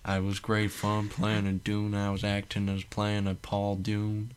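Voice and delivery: slow, drawling [name] impression; hammed-up [name] press-tour voice; [name] accent. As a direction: drag it out like [name] in an interview. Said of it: "now he's [name] doing a different accent".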